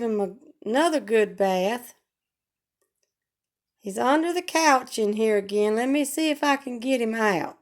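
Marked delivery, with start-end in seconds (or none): none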